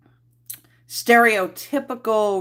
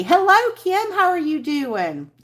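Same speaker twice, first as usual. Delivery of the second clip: high-pitched greeting; 11 semitones higher, 4 dB louder than usual. Real, not acted. Streamer greeting a viewer in the live chat, high-pitched. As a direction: greet her high up, easing down at the end.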